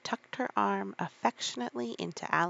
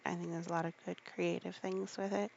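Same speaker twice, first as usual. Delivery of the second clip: usual speaking voice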